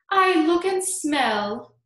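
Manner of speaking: high, loud, drawn-out self-admiring verse; Aunt Sponge declaring. A narrator's reading. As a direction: declaim it slowly, utterly in love with yourself. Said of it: "Aunt Sponge declared".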